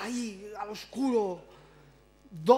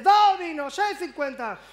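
very loud, much higher shout of the price; yelling waiter; 8 semitones higher, 10 dB louder, slower than usual. Retold or imitated. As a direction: bark the bill at the customer.

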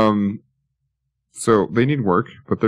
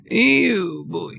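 disgusted ew; 10 semitones higher than usual. Real, not acted. Reacts to empty loan.

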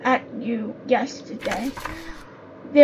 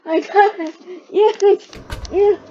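louder, rising triumphant cheer; excited triumph; 6 semitones higher, 4 dB louder, faster than usual. Real, not acted. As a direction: celebrate the win. Success after struggle.

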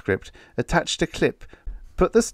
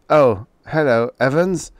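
louder, eager-to-please greeting; ingratiating politeness; 6 semitones higher, 7 dB louder than usual. Acted. Writer greeting his editor on the phone, ingratiating. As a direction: suck up to the editor. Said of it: "his voice becoming polite, ingratiating".

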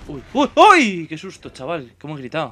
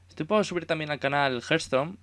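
ordinary delivery

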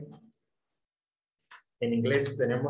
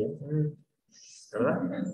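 normal talking voice

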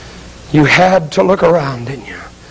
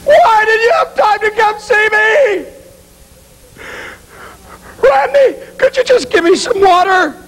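high, crying wail; tearful screamed plea; anguished pleading; 13 semitones higher than usual. Acted. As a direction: beg and reproach through sobs. Damned soul begs for water.

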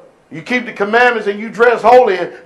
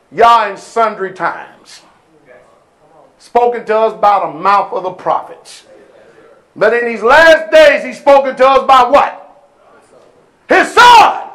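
preaching; 3 dB louder than usual. Real, not acted. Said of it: "quoting Hebrews to congregation".

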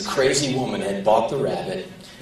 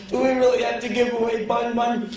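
put-on pleading kid; 10 semitones higher than usual. Kids pleading with mother.

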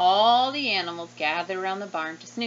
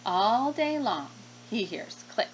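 ordinary delivery

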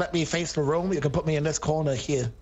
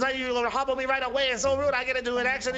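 higher-pitched, lightly voiced complaining old man; aggrieved old veteran; 5 semitones higher, faster than usual. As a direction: play a wronged old vet pleading his case. Plays old veteran Bill Dean.

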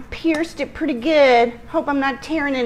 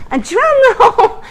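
laughing, higher and louder announce; laughing drum roll; 10 semitones higher, 5 dB louder than usual. Real, not acted. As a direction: giggle while announcing. Presenting the cake, giggling.

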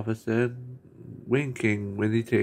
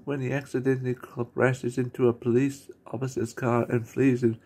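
normal talking voice

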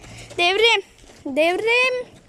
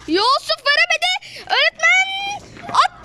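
screaming football commentator; 8 semitones higher, slower than usual. Acted. Kid doing live play-by-play.

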